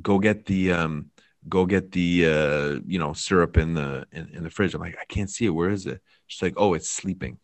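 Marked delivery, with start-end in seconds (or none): none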